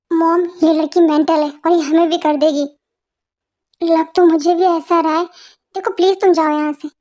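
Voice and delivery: normal talking voice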